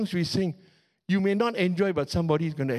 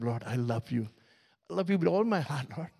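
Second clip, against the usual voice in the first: hushed, softer loving prayer; tender prayer; 4 semitones lower, 4 dB quieter than usual. Acted. Lover speaking to the Lord, soft and tender. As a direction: whisper it like a love letter.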